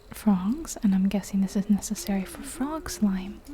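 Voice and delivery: ordinary delivery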